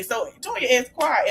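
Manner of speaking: usual speaking voice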